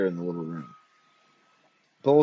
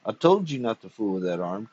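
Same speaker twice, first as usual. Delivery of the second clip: ordinary delivery, nothing marked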